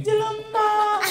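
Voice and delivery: very high, slow, sung wail of a female ghost; bride's eerie high sing-song reply; ghostly kuntilanak voice. Acted. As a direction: croon it like a kuntilanak in the dark. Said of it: "siun kuntilanak".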